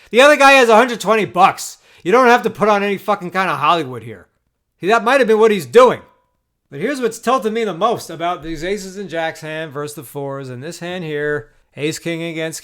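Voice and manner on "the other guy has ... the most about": loud, heated, indignant complaint; furious rant. Real, not acted. Ranting about a bad beat.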